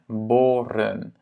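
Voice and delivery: boren slowly, with a long O; long first vowel. Shown as a demonstration. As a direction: draw out the O in boren. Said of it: "la première voyelle est longue". (« boren » lentement, O long)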